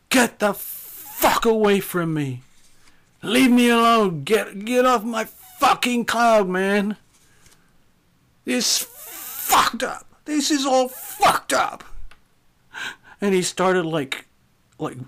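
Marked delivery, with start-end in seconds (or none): 0.1-11.8 high, strained yelling, dragging the curses out; slow, high-pitched anguished shouting; angry drunken outburst. Retold or imitated. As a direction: act out a wrecked man lashing out. Retelling injured drunk man's angry outburst.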